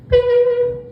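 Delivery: high squeaky mock beep; answering machine beep. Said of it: "it would be like".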